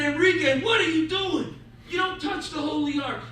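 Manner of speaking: high, loud rabbi's rebuke; shocked scolding. Acted. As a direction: scold him as if caught red-handed. Rabbi catches man at ark.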